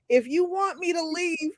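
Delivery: high, lightly voiced missionary; mock missionary. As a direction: say it like a polite door salesman. Voicing missionary's offer.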